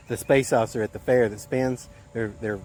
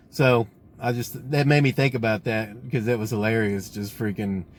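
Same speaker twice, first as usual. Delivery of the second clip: usual speaking voice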